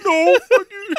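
laughing retort. Retold or imitated. Coworker retorts while laughing.

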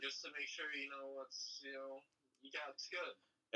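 lightly voiced smug host; a hint of a breezy TV host; mock Chris Harrison. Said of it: "He's like".

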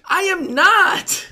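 high, loud, very drawn-out protest; indignant denial. Pretend. To the co-host accusing him, indignant high protest. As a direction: stretch out each word in mock indignation.

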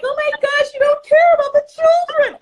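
high, loud mock outrage; hammed-up shocked gasp of blame; mock outraged parent. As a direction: squeal it like a scandalized parent. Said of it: "be like".

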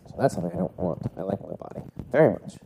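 usual speaking voice

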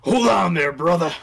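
high, loud wrestler growl; Hogan. Acted. Game master hamming up a magic item, loud wrestler impression. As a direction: boom it out like a hyped-up wrestler. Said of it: "Belt of the Hogan".

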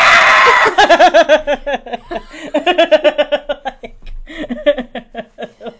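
long burst of loud laughter. Real, not acted. Laughing after shout.

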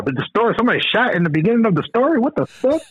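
high, fast, baffled outcry; mock confusion. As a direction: pretend to be lost in the story, pitch flying up. Confused by slang story.

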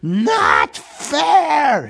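high, loud creature voice spitting the words; Gollum's high, loud complaint; Gollum hissing. A narrator's reading. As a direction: hiss it out as a wretched cave creature. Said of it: "he hissed".

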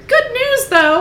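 very high, loud Capitol squeal; silly Capitol accent. A narrator's reading. Reader redoing Venia's line in character, silly high Capitol accent. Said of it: "speak in such a high pitch".

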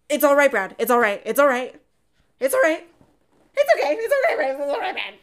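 frantic, high and loud, over and over; panicked reassurance. Retold or imitated. Frank panicking as he reassures Brad, high frantic shouts. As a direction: soothe Brad while panicking yourself. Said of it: "And he's so freaked out. He's panicking".

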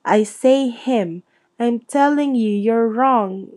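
ordinary delivery, nothing marked

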